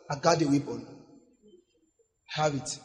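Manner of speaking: low, soft, assured reply; cool refusal. Acted. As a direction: brush the enemy off quietly. Believer coolly answers the disarmed enemy.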